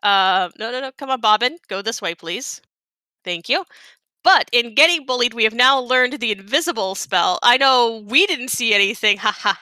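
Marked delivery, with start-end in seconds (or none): none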